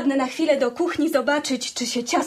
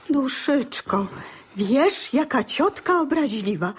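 normal talking voice

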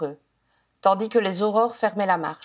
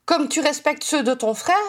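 higher-pitched, mocking question; sneering; 6 semitones higher than usual. A narrator's reading. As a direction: throw it back with a sneer. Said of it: "ricana Janet". (question moqueuse, en ricanant)

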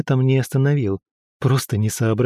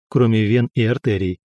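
ordinary delivery, nothing marked

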